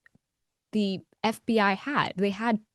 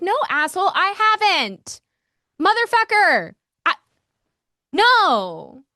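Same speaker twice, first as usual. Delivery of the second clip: high, loud mock fury; angry retort; 11 semitones higher, 6 dB louder than usual. Speaker answering commenters who doubted her, loud high angry retort. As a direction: snap back at the doubters.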